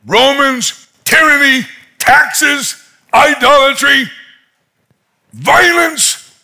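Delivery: high, punched-out list of grievances; crying out to God. Retold or imitated. Nathaniel to God about his troubles, crying out. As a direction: fling each one up at heaven. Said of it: "he's crying out to God".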